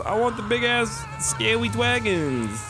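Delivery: hoger en luider, theatraal teleurgesteld; dramatic dismay. Real, not acted. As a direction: jammer overdreven om de vogeltjes. Disappointed that birds arrived.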